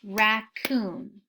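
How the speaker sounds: Raccoon said slowly as rac-coon, two clapped beats; clapped syllables. Shown as a demonstration. Teacher to class, clapping Raccoon out in two beats. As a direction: split Raccoon into two even claps. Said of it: "Did you hear it".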